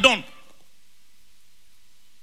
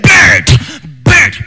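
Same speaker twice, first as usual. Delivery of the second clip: commanding call. Acted. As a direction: call the creature into being. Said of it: "So it says".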